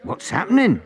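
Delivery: high, loud call from the back. A narrator's reading. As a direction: shout over the crowd. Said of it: "called a voice from the back".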